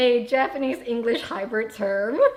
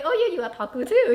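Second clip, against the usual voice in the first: higher, quicker; 6 semitones higher, 6 dB louder, faster than usual. Shown as a demonstration. Volleying otaku banter back, higher and quick. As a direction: lift the pitch and rush it, teasing. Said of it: "And you're like".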